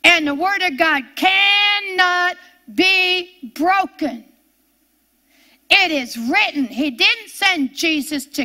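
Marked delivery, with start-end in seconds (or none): 0.0-4.2 slow, shouted proclamation; shouting with conviction, a little higher; preaching shout. Real, not acted. 5.7-6.6 loud preaching cadence; fervent preacher, driving the words home. Acted. Preacher proclaiming scripture.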